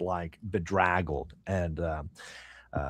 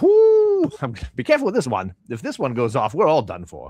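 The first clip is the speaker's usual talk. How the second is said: high, loud whoop, then quick caution; whoop, then hurry out the warning; warning shopkeeper; 6 semitones higher, 6 dB louder than usual. Acted. Shopkeeper warning customers about a dangerous arrowhead, whooping then hurried.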